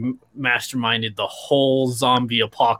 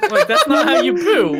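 laughing; 5 dB louder than usual. Real, not acted. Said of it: "laughing while talking".

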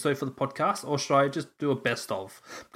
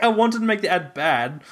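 usual speaking voice

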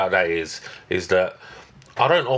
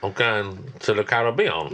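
put-on Caribbean accent. Telling a co-host about holiday plans, in a mock Caribbean accent. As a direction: put on a jokey Caribbean accent, stretching the word. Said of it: "that was my, um, Caribbean accent".

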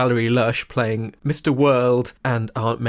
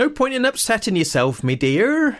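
high-pitched mock dismissive auntie; Aunt Mary; 9 semitones higher than usual. Podcaster acting Aunt Mary hushing Minty, mock dismissive. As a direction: brush it off in a put-on auntie voice. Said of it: "Aunt Mary's like".

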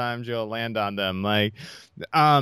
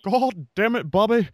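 higher, louder exasperated Texan dad; Hank Hill; 6 semitones higher, 3 dB louder than usual. Pretend. Repeating his Hank Hill, scolding Bobby. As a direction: scold Bobby like a fed-up Texas father. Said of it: "the Hank Hill impersonation".

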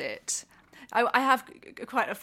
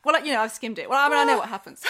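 imitating son's excuse; 3 semitones higher, 8 dB louder, much faster than usual. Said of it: "son's excuse".

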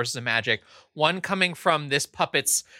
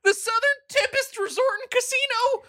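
high puppet voice. Acted. Puppet being interrogated.

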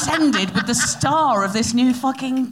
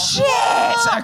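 sudden high shout of surprise, louder; surprised shout; 4 dB louder than usual. Real, not acted. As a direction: blurt it out, shocked. Snacks revealed.

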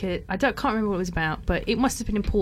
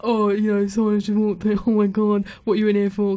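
put-on louder, rowdy teenage boy voice; mocking rowdy lads; 5 dB louder than usual. Imitating detention boys heckling the new arrival, loud mock jeer. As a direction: ham up the naughty lads jeering at the newcomer. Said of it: "these kind of boys kind of like".

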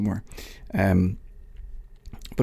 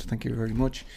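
ordinary delivery

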